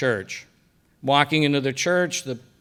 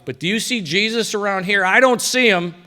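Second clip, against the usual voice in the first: retold angry outburst, pitched high and getting louder; worked-up outburst; 8 semitones higher, 4 dB louder than usual. Husband fed up with friend's questions about Jesus, worked-up outburst. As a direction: make a scene, voice leaping up in protest. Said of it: "I was making a bit of a scene".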